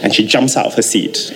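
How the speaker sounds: ordinary talk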